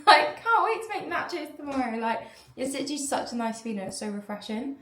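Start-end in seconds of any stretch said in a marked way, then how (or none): 0.1-2.0 giggly excitement. Real, not acted. Giddy about tomorrow's nachos.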